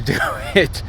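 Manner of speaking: normal talking voice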